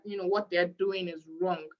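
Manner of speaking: ordinary talk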